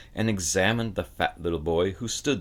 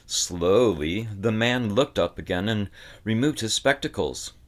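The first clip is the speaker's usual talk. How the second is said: ordinary delivery, nothing marked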